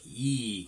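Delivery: long, drawn-out "E"; teacher modelling "E" slowly; E sound. Shown as a demonstration. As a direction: stretch out "E" for children to copy. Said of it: "Can you say E?".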